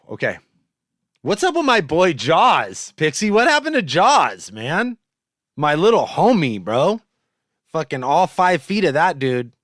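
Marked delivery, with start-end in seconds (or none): 1.2-9.5 excited calling. Real, not acted. Loudly hailing a missing friend.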